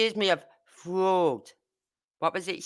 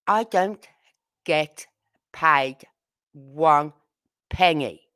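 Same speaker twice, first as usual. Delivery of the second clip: a pause after nearly every word of "I don't get paid one penny"; word-by-word emphasis; 3 dB louder, much slower than usual. Real, not acted. Spelling out that she earns nothing.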